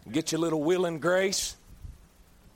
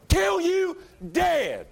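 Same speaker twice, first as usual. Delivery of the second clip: preached shout; 10 semitones higher, 5 dB louder, much slower than usual. Acted. Sermon climax.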